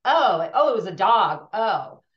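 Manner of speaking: loud mock dismissive "oh"; judging dismissal. Imitating people comparing griefs when it was a dog, judging. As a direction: imitate someone belittling a loss. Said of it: "is the comparing and judging".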